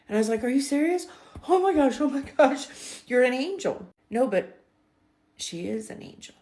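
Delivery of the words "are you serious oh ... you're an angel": high, loud, gushing gratitude; thrilled disbelief. Retold or imitated. Traveler hearing the agent will fix her ticket, thrilled disbelief. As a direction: burst out in overjoyed amazement.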